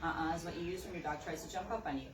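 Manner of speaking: ordinary talk, nothing marked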